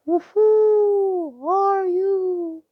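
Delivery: very high, loud, drawn-out hooting owl; hooting owl asking a question. Acted. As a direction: stretch each word like an owl's hoot. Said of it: "She hoots a question".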